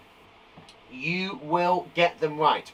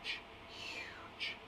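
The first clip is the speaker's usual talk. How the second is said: whisper; 21 dB quieter than usual. Shown as a demonstration.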